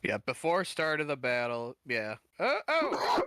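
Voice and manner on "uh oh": a sudden high uh-oh; high startled uh-oh; surprised. Real, not acted. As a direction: catch yourself in alarm. Sudden realisation mid-ruling.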